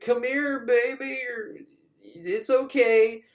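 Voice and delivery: high, coaxing pet voice; guard coaxing her like a dog. Retold or imitated. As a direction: coax her like a puppy. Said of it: "feed her like she's a dog".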